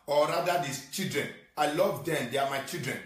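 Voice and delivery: ordinary delivery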